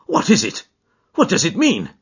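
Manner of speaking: high, loud, gasping questions; frightened, urgent questioning; horrified alarm. A narrator's reading. Horrified questions after the scream.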